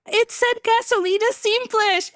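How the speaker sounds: high-pitched, flustered defence; retold protest. Driver defending herself to attendant, high retold protest. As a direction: protest it high, acting out dismay.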